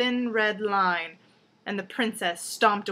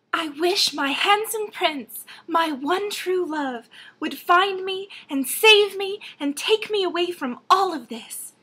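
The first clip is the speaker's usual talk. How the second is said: cartoon princess; 8 semitones higher, 4 dB louder than usual. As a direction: sigh it out like a storybook princess. Princess voiced in fairy tale.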